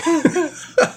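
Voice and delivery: squealing burst of laughter; real laugh at the absurd trash talk; high laugh. Real, not acted. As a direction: crack up, high and sudden.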